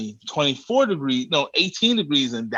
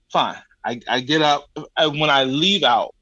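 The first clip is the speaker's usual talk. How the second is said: usual speaking voice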